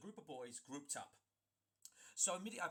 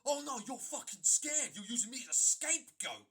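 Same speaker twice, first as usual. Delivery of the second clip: much higher, loud jeering voice; mock him loudly as a coward; loud taunting accusation; sneering accusation; 8 semitones higher, 13 dB louder than usual. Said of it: "he's like".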